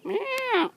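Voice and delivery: squeaky high cat meow. Pretend. Meowing at cat.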